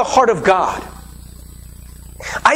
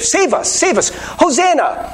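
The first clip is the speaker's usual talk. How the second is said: higher, pleading cry of Save us; crowd crying out; 5 semitones higher than usual. Retold or imitated. Preacher voices the Jerusalem crowd, lightly pleading. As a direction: plead like a crowd calling to Jesus.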